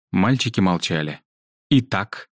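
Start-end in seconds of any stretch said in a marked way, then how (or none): 1.7-2.3 higher, demanding prompt. A narrator's reading. Headmistress waits on two silent boys, demanding. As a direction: press for an answer. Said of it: "требовательно спросила госпожа Мельцик".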